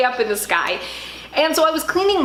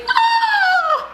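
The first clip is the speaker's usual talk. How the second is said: very high, drawn-out scream; retold shriek; 4 dB louder than usual. Woman locked out of her apartment, high retold scream. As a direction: imitate a high-pitched panicked shriek.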